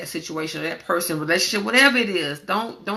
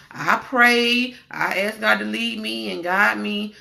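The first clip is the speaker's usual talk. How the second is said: ordinary talk, nothing marked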